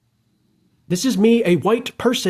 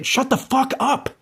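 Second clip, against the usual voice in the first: imagined shout; 9 semitones higher than usual. Retold or imitated. Imagining shouting at people from the bandstand, a high mock shout. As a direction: yell it in pretend, pitched up. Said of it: "I'm gonna start shouting at people and say".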